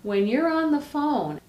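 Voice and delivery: high, loud phone voice; loud phone talk. Shown as a demonstration. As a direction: project as if talking on a cell phone. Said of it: "we talk really loudly on cell phones".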